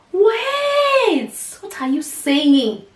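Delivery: high startled wait, then stunned question; shocked disbelief. Retold or imitated. Telling the surgeon she's shocked, high and stunned. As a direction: recoil at the news, voice jumping up. Shocked at news of ovary removal.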